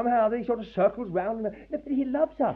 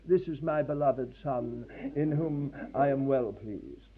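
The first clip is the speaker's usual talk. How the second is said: slow, softer, calm English civil servant voice; 5 semitones lower, 6 dB quieter than usual. Retold or imitated. God mocked as a calm English official, slow and soft. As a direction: say it like an unmoved official, quietly and slowly. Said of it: "in a very calm English way".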